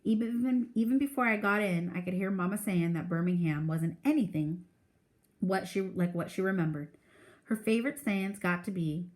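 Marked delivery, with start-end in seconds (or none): none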